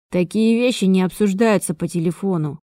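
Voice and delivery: usual speaking voice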